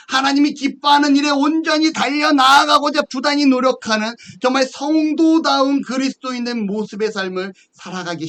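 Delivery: high, insistent preacher's cadence; emphatic preaching. Acted. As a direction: drive each point home from the pulpit. Preaches with force.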